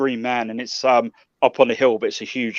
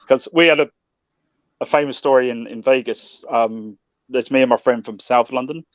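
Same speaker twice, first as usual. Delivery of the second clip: usual speaking voice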